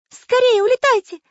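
very high, loud cartoon cry; high-pitched urgent shout; urgent plea. A narrator's reading. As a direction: beg the pilot to hurry. Pencil begs pilot to flee.

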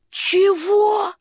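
put-on high squeaky wooden boy; puppet boy voice. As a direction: ask it high and childlike. Buratino puzzled, high voice. (тонкий голос Буратино)

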